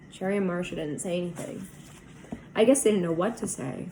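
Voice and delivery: plain reading voice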